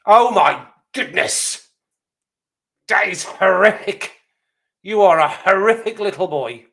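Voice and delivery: loud, high, slow hammed-up horror; horrified outburst. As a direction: play an appalled teacher, drawing out the outrage. Teacher horrified by the pink fluff.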